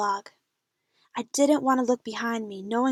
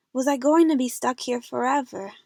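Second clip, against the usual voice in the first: plain reading voice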